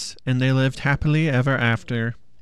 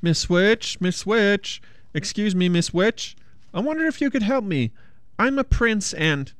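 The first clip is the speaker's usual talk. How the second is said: timid prince; 7 semitones higher than usual. A narrator's reading. Prince addressing second witch.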